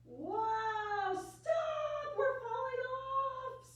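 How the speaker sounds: high squealing cry to stop. A narrator's reading. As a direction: shriek it like you're slipping off. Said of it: "squealed the cat and the dog".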